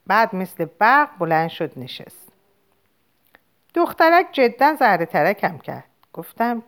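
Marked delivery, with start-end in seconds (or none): none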